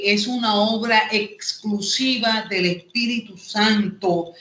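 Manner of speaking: ordinary talk, nothing marked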